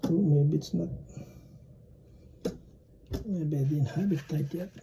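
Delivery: ordinary talk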